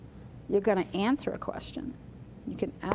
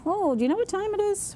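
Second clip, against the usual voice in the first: much higher, lilting question; 12 semitones higher than usual. Shown as a demonstration. Teacher acting out asking a stranger for the time, higher. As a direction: lift the whole line like a real question. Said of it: "you're really kind of making it".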